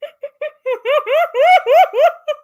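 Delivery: shrieking high laugh; real loud laughter. Real, not acted. Reader losing it over the fan fiction, loud laughter. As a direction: burst out in high, loud cackles. Cracks up at the story.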